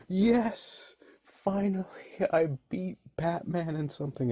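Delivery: high, giddy cry of triumph; gleeful villain. Retold or imitated. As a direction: crow over a win you did not earn. Riddler gloats over a fake win.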